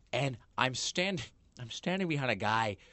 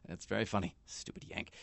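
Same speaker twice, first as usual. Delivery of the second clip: tight mock chuckle, voice dropping away; seethingly bitter laughter; 2 semitones lower, 7 dB quieter than usual. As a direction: choke back fury behind a constricted laugh. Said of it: "the seethingly bitter laughter".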